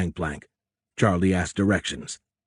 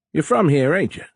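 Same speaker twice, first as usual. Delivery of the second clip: high, loud Cajun captain; high-pitched Cajun question; thick Cajun accent; 8 semitones higher, 5 dB louder than usual. A narrator's reading. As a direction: ask it heartily in Cajun. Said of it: "in a thick Cajun accent".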